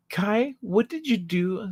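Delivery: lightly acted parental questioning; suspicious parents. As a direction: ask it like a parent who suspects mischief.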